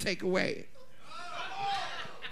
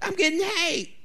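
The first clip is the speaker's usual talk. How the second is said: mock pleading; 7 semitones higher, 5 dB quieter than usual. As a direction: plead in a put-on higher voice. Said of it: "the way they asking me".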